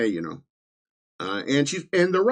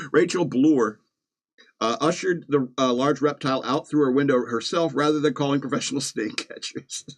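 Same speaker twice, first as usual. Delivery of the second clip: ordinary talk, nothing marked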